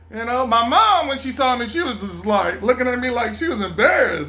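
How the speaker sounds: normal talking voice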